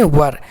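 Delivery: वर as one syllable, neutral vowel into r; correct "were" pronunciation. Shown as a demonstration. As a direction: say वर like "wur", no ee or ay. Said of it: "तो इसको क्या पढ़ेंगे".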